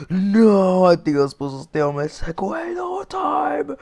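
high, loud cry of protest; dismayed shout. Real, not acted. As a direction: wail it out as it all goes wrong. Loses vehicle in game.

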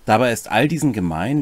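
ordinary talk, nothing marked

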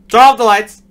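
sudden frantic yell; panicked shout. Real, not acted. Panicked shout in horror game.

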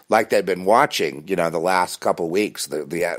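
usual speaking voice

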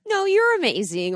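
high, drawn-out deflection; gushy, high brush-off; deflecting a compliment. Acted. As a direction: bat the praise straight back, pitched high. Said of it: "try to bounce it off of you".

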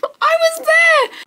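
high, slow, squealed exclamation; excited squeal. Real, not acted. Vlogger squealing to viewers about being there. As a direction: squeal it out in disbelief.

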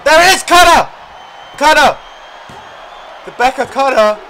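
commentator screaming the finisher; excited shout. Real, not acted. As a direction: yell the move name. Calling the finishing move.

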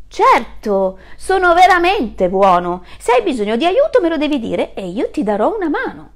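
good-hearted wolf offering help; kind wolf. Acted. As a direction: reassure the little one boldly. Wolf answers kid.

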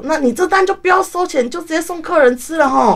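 imitating the boss giving up on the payment; boss's instruction. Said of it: "然后老板这时候说".

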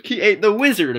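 high, loud amazed exclaim; stunned reader rereading the story; real surprise. Real, not acted. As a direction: blurt it out in disbelief. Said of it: "reader realizes mistake".

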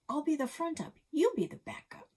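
put-on squeaky, eager kid insisting; high excited kid voice. Kids arguing roles, high voice.

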